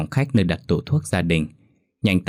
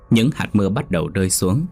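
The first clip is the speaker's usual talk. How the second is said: usual speaking voice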